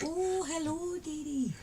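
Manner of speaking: high, soft, sing-out greeting; light, high Scottish-style hello; Mrs Doubtfire. Shown as a demonstration. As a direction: greet like a Scottish nanny character. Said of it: "Missus Doubtfire when I try Scottish".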